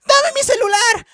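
mocking a telenovela girl screaming for her phone; hammed-up screaming girl. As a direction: wail for the phone like a hysterical teen. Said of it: "dice de que".

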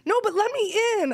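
very high-pitched mock protest; imitating pleading husband. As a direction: plead to be let in, exaggerating his frustration. Wife voices husband pleading at door.

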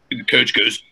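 normal talking voice

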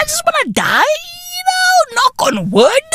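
high, mock-crying wail; mock crying whine. Vlogger mocks himself complaining about the heat, high mock wail. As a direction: whine it like a melodramatic crier. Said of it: "I was like".

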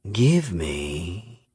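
slow, softened villain's purr; Miyamune purring. A narrator's reading. Predator repeats her price for a hostage, purring menace. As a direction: linger silkily on the demand. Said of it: "Miyamune purred".

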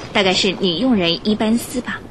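usual speaking voice

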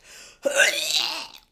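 loud retching gasp; dry heaving gasp. Retold or imitated. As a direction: heave out a gasp. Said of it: "I start dry heaving".

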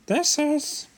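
very high squeaky character; high put-on hero voice; Lloyd voice. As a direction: pitch it far above your own voice. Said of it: "Lloyd says".

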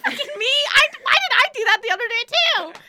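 high, loud, fast, laughing at herself; excited outburst. Real, not acted. Admitting to her friend she took the quiz, excited and loud. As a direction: blurt it out, half embarrassed and thrilled.

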